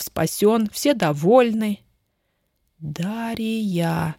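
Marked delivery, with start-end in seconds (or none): 2.8-4.2 softly breathed name, held back indignation; barely audible, trembling. Acted. Ellen quietly addresses Daria, hurt and indignant, barely audible. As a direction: say her name almost under the breath. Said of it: "чуть слышно дрожащим от возмущения голосом".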